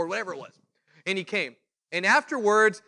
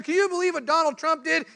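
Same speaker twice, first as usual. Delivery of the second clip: much higher, scandalized question; outraged gossip; 10 semitones higher, 3 dB louder than usual. Retold or imitated. Acquaintance griping about Trump, scandalized outrage. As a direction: ask like you can't believe the news.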